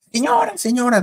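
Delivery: high urgent call to the mother; kids calling out. Retold or imitated. Kids run to Mateo's mother, calling her high and urgently.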